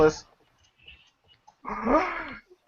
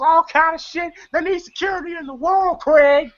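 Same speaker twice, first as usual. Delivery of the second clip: high, excited mock boasting; worked-up retelling; 8 semitones higher than usual. Security guard hyping up Craig, mock worked-up. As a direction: imitate a fired-up movie character, pitched high.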